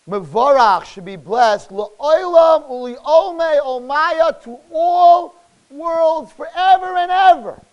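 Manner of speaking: loud, drawn-out proclamation; slow, rising declamation; preacher's declamation. Acted. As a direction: proclaim the prayer word by word. Declaims prayer with translation.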